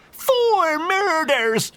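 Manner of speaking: very high, drawn out, loud; defensive cry. A narrator's reading. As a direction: fling the accusation back. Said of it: "replied the priest with a defensive thrust".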